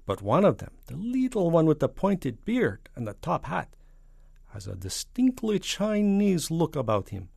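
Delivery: high, loud, deliberate foreign statesman; Soviet premier. Acted. Soviet premier describing the astronauts to the President, deliberate. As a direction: explain it emphatically, lingering on key words.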